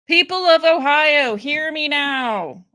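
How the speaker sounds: loud, high, slowing public address; mock proclamation. Host appealing to fellow Ohio voters, mock proclamation. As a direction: summon the crowd, stretching the last words.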